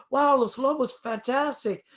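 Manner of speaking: high-pitched, mildly starstruck exclamation; retold delighted greeting. George greeting the band backstage, delighted. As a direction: imitate someone thrilled to meet a band.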